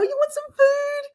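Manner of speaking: high, slow pet-coaxing voice; baby talk. Retold or imitated. Offering her chicken food in cooing baby talk. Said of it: "make those baby noises that you do".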